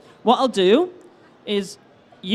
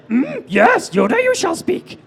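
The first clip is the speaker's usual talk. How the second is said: high-pitched, creaky little creature voice; hum, then speak in a high put-on voice; Yoda; 7 semitones higher than usual. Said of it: "do more Yoda impressions".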